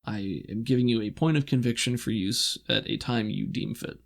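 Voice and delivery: ordinary delivery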